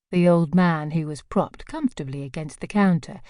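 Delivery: ordinary delivery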